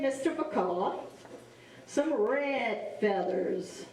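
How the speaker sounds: ordinary delivery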